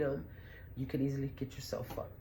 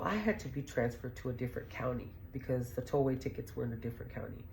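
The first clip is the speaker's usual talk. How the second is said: ordinary talk, nothing marked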